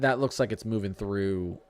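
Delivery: ordinary talk, nothing marked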